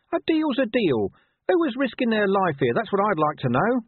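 a scrappy mutt demanding his due; indignant talking dog. Acted. Dog protests lost steak.

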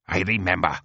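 curt, angry comeback; snapped retort. Acted. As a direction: snap it back at the queen. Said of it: "snaps Ulibar".

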